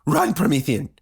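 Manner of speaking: high, frantic shout; urgent high-pitched yell; mother's scream. A narrator's reading. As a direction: scream it as a desperate plea to flee. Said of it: "she screamed".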